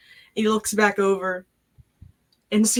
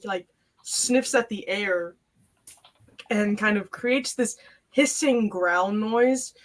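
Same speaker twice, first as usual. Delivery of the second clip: ordinary talk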